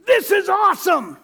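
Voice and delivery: high, loud whoop of glee; delighted shout. Retold or imitated. Husband thrilled on the dark coaster, shouting high. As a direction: shout it like the best ride ever. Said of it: "I'm thinking".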